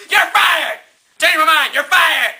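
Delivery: high, loud firing shout; angry boss. Acted. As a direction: yell the firing at him. Nixon keeps firing.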